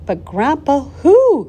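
high, loud burst of curiosity; insistent cry. A narrator's reading. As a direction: cry out the question to Grandpa. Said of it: "cried Charlie".

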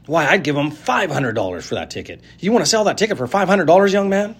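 outbidding eagerly; pushy woman. A narrator's reading. Woman bidding loudly.

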